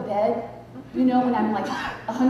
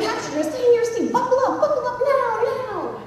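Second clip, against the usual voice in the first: high, frantic intercom order; urgent mock scream; imitated screaming; 12 semitones higher, 3 dB louder than usual. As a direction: mimic the pilot yelling at passengers. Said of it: "he was screaming".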